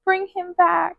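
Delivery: high, half-voiced mock scream of grief; high pleading cry, not shouted; retold anguished scream. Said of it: "she just kept screaming".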